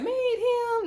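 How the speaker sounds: high, breaking into laughter; incredulous laugh. Real, not acted. Vlogger reacting to a cast member's claim, incredulous laugh. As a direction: squeal it in disbelief.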